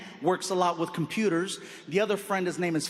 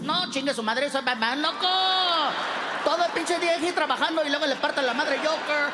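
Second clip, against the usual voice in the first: Mexican gang member voice; 5 semitones higher than usual. Retold or imitated. Batman by day as a Mexican gang member, higher and ranting. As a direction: rant like a tired Mexican homeboy. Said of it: "During the day?".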